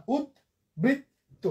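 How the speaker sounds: উদ্বৃত্ত drawn out as ud, brit, to; syllable by syllable. Shown as a demonstration. Teacher counting three syllables, slow and separated. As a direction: pause between the beats of উদ্বৃত্ত. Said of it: "তিনটা".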